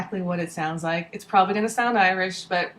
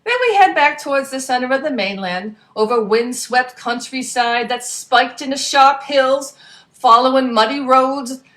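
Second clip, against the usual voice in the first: louder, brisk storyteller voice; lively story narration; 4 semitones higher, 8 dB louder than usual. A narrator's reading. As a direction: tell the adventure with gusto. Reads story in character voice.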